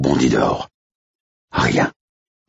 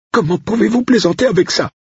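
high, louder, reproachful voice; indignant cook; 4 dB louder than usual. Acted. Cook reproaching Blake for joking, high indignant voice. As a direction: scold him, high and shocked.

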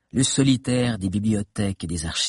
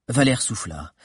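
plain reading voice

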